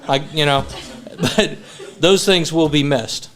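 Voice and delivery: usual speaking voice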